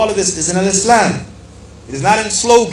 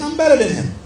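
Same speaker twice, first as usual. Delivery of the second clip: arrogant boast, pitched higher; voicing Iblis; 8 semitones higher than usual. Preacher acting out Iblis's boast, arrogant and higher. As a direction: puff up and say it like a proud rebel. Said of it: "Iblis said".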